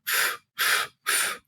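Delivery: soft unvoiced f from open lips; demo f sound. Shown as a demonstration. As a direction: let the f fade out quietly. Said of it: "we start with our lips open".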